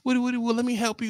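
high, soft mock wife voice; eager wife. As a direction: play the wife sweetly shooing him off. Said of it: "And she like".

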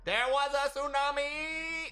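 slow, high and loud exclaim; excited exclaiming. Real, not acted. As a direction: stretch it out in disbelief. Retelling sudden tsunami.

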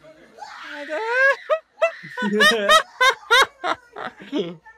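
high screaming laugh; shrieking laugh. Real, not acted. Loud laugh at video.

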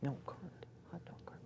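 very soft whispered correction. Real, not acted.

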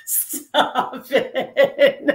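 helpless with laughter; laughing protest. Real, not acted. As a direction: squeeze the words out through real laughter. Cracking up at co-host's demon joke.

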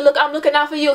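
mildly heated self-defence; retold arguing. Retold street argument.

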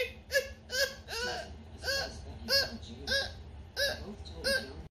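high, shaky laugh breaking into crying; mock hysterical laugh-cry; hysterical laughing sob. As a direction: laugh and cry at once in fright. Nervous outburst.

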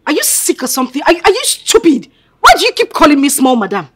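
loud, high, exasperated scolding; angry outburst. Acted. Daughter scolding the maid over the name, loud and angry. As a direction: snap at the maid, fed up.

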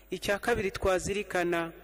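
ordinary delivery

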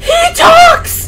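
high startled shout with a laugh; shocked shout. Real, not acted. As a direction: cry out in disbelief. Shocked discovery.

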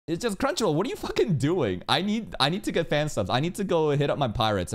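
high-pitched, exasperated complaint; real fed-up rant, voice pitched high. Real, not acted. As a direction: vent in exasperation, pitch jumping up. Ranting at Crunchyroll over bad subtitles.

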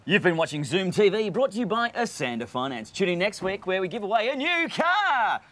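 performed on request to close the show; voiceover announcer. Shown as a demonstration. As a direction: sell it like a TV promo. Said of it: "say goodbye to people. Give it a go".

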